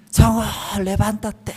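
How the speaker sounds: high-pitched wake-up holler; imitated parental yell. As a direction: mimic a parent calling a child out of bed. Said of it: "así grita todos los días".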